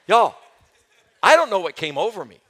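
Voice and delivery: usual speaking voice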